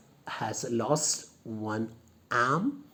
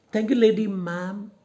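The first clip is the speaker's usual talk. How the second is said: a touch higher and louder, polite lady; 1 high voiced lady; 7 semitones higher, 5 dB louder than usual. Shown as a demonstration. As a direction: read her line as a gracious hostess.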